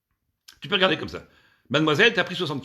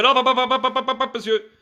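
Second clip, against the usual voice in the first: higher, rapid-fire string of hops; interrupting policeman; 8 semitones higher, 5 dB louder than usual. Acted. Officer stopping man paying the woman, urgent interruption. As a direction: cut in with a volley of syllables.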